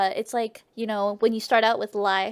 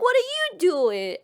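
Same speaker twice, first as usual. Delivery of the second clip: higher-pitched retort, throwing it back; mock road rage; 7 semitones higher than usual. Traveller answering road-raging soldiers, mock indignant retort. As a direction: imitate an indignant comeback. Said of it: "They were just road raging at each other".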